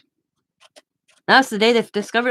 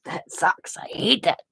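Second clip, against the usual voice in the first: whispered gripe; 5 dB quieter, slower than usual. Real, not acted. Aside griping about acid medication.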